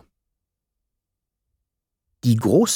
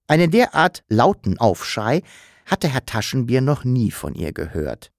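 ordinary delivery

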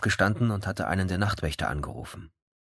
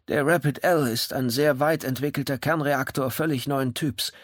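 higher, uneasy but proud voice; worried yet proud; 8 semitones higher, 3 dB louder than usual. A narrator's reading. Kemba explains his reactor, worried yet proud. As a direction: worry underneath, pride on top. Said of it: "mit einer von Sorge und Unsicherheit geprägten Stimme, in der gleichwohl auch Stolz lag".

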